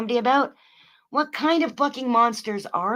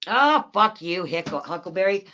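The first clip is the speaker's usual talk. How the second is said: usual speaking voice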